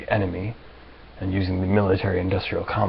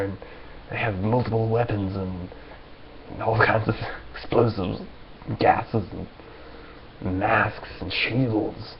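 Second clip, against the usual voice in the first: ordinary talk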